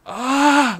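drawn-out high "ah" of retold shock; imitated scream. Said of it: "I was just screaming".

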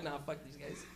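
low, soft lazy brush-off; lazy dismissal. Retold or imitated. Stoned cops brushing off smokers, low muttered. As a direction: toss it away under your breath. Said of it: "They're like".

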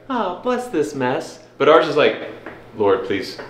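ordinary delivery

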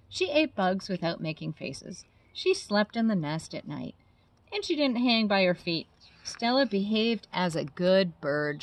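put-on lively storybook narration for kids; reading to children. A narrator's reading. Biologist reading a bat story to children, animated. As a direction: read it like bedtime story time. Reading a picture book aloud.

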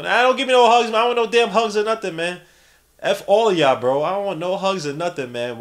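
high, loud playful rant; mock indignant refusal. Streamer refusing a viewer's hugs, mock indignant outburst. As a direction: act put out and wave the affection off.

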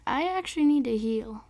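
usual speaking voice

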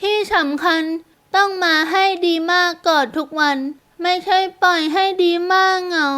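put-on high-pitched little girl laying down rules; small voice, measured. A narrator's reading. Girl listing rules for her would-be dad, small voice. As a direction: spell out each condition as a child. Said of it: "เสียงเล็กๆพูดช้าๆ".

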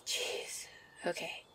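breathy whisper of relief; sneaking a relieved whisper once Mia is gone. Acted. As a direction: whisper it, the danger just passed. Relief after hiding from Mia.

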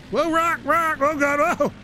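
high startled whoa; real startled outburst. Real, not acted. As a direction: caught off guard by a rock on the road. Sudden surprise while driving.

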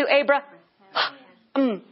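Abra-, then an audible breath, then mm; breath mid-name. Shown as a demonstration. Preacher showing God's breath in Abram's new name, Abraham broken by a breath. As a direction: split Abraham with a big breath for the H. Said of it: "put the breath right in the middle".

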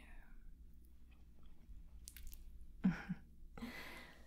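long, soft, breathy laugh; knowing chuckle. Acted. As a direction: laugh quietly to yourself, knowingly. Vampire amused at being familiar.

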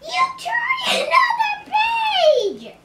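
very high, drawn-out wail; panicked scream. Acted. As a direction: shriek it slowly at full volume. Grover panics at turned page.

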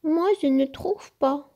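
high-pitched objection; high storybook protest; crying out. A narrator's reading. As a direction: exclaim in disagreement, high. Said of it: "s'écria Peach".

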